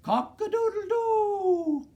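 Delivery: very high, loud crow with a long held "doo"; rooster crowing. A narrator's reading. Reader to children as the rooster, loud drawn-out crowing. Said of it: "crowed the rooster".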